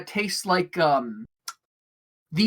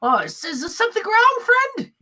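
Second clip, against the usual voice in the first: high-pitched kobold barkeep checking on a customer; concerned bartender; 3 dB louder than usual. Acted. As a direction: ask in a high character voice. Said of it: "he goes".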